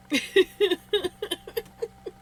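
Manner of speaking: high, rising chuckles; helpless laugh. Real, not acted. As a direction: keep giggling up high. Laughing after oh my gosh.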